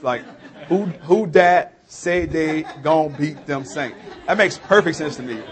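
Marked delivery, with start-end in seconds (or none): 0.7-4.0 slow, drawn-out New Orleans dialect; New Orleans accent. Shown as a demonstration. Local repeating the phrase for baffled listeners, slow New Orleans accent. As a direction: spell it out slowly in New Orleans talk. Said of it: "to me, that makes perfect sense".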